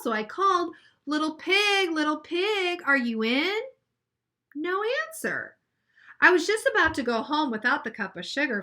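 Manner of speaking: put-on expressive read-aloud for children; reading a picture book to a class; story narration. A narrator's reading.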